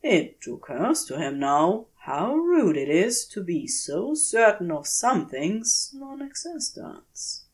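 ordinary delivery

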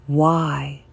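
ordinary delivery

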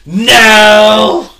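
long, high, loud imitation refusal; mock defiant yell. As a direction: holler it as a hammed-up indignant customer. Acting defiant customer refusing masks.

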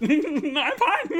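high laughing I'm fine. Real, not acted. Laughing at meds comment.

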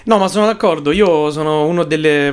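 usual speaking voice

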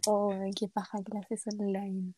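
ordinary talk

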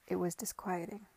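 plain reading voice

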